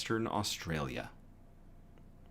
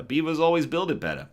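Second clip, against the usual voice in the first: Boston accent; 6 semitones higher, 5 dB louder than usual. Shown as a demonstration. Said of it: "Now say it with a Boston accent".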